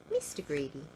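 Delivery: ordinary delivery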